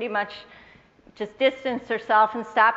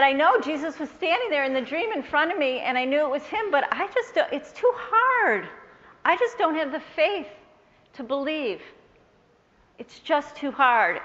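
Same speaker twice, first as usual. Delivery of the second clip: strained, higher voice breaking near tears; anguished plea; 4 semitones higher than usual. Retold or imitated. Telling Sarah about the dream, anguished and near tears. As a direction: pour out your doubt to your friend, slowing as it hurts. Retelling her struggle to believe.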